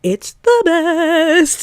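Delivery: high, drawn-out singsong rave; gushing. Real, not acted. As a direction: stretch every word in delight. Gushing about the radio game.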